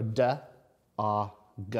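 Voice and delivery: "d, ah, g" as three separate sounds; sounding out. Shown as a demonstration. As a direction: split dog into "d", "ah", "g". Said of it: "I'm stretching out those words".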